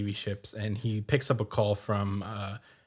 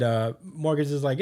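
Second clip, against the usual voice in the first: ordinary delivery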